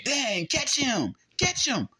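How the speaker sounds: high, exasperated mock fan cry; mock-frustrated, slow and high; mad fans. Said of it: "people be mad".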